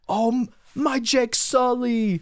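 high, slow overacted movie line; mock dramatic line. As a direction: ham up the lovesick declaration. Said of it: "count how many times they say Jake Sully".

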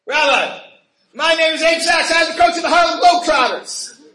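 a fan bursting up to a rabbi, calling out his name; passionate loud introduction. Retold or imitated. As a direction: announce yourself loudly and passionately to the Rebbe. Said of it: "very vociferously, very passionately".

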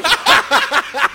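high, loud burst of laughter. Real, not acted. Host cracks up at his co-host's teasing, real loud laugh. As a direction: crack up loudly at the joke.

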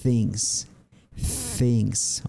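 THINGS starting like a Castilian Z; isolated THINGS with a clear opening TH; initial TH sound. Shown as a demonstration. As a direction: say THINGS alone, tongue-between-teeth TH first. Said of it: "se pronuncia como una Z".

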